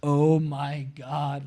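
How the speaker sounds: slow, stretched out oh my God; awed gasp. Retold or imitated. Surfer stunned by the swell stacking up, slow awe. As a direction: let the awe drag the words out.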